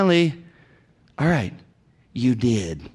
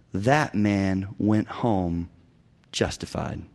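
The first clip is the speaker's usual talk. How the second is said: hushed solemn pronouncement; 8 semitones lower, 5 dB quieter, much slower than usual. Retold or imitated. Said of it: "And Jesus said".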